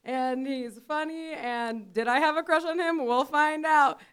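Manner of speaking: stretched, booming guest introduction; weird announcer voice. Pretend. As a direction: build the hype, drag out the name. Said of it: "I'm doing this weird announcer voice".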